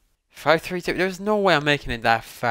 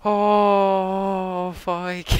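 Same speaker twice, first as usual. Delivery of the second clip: shrieking jackpot winner; 8 semitones higher, much slower than usual. Real, not acted. Shrieks after near miss.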